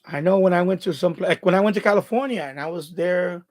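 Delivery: ordinary talk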